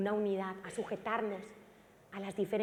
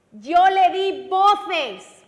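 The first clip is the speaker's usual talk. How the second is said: slow booming shout, God calling out; 7 semitones higher, 15 dB louder, much slower than usual. Shown as a demonstration. Preacher shouting God's words to the church. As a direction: shout each word slowly like God calling. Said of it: "Dios nos tiene que gritar".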